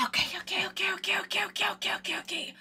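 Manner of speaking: nervous-sounding quiet chant to get started; rapid muttered okays. Real, not acted. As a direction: rattle them off under your breath. Stalling before starting the rant.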